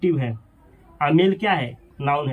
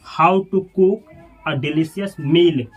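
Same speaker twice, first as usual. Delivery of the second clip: slow model sentence; 4 dB louder, much slower than usual. Shown as a demonstration. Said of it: "teacher models English sentence".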